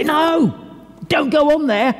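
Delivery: high-pitched alarmed call-out; urgent warning cry. Acted. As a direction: call out to stop someone in danger. Shouting a warning to someone straying.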